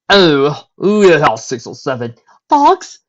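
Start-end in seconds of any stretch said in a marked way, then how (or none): 2.5-3.0 high startled squeak; shocked disbelief, shooting up high. Acted. Rarity shocked at dog count.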